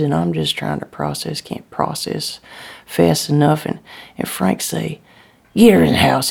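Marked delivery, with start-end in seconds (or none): 5.6-6.3 raised, louder voice giving an order; urgent order. A narrator's reading. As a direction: call it out as a command. Frank orders wife to take child inside.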